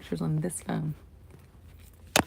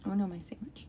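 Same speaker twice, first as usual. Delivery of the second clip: softer whispered oh no; hushed dismay; 7 dB quieter, faster than usual. Real, not acted. To herself, spotting the cat at her sandwich, whispered dismay. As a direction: whisper your alarm.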